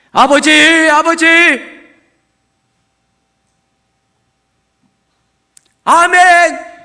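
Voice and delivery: loud, high crying prayer; fervent cry. Retold or imitated. Believer crying out to God in loud prayer. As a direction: cry out to God then amen.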